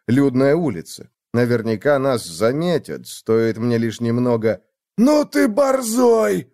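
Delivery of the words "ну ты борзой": high, slow, far thug voice; barked threat. Acted. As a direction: bark it like a street thug. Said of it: "гаркнул бандит с дубинкой". (грубый окрик бандита)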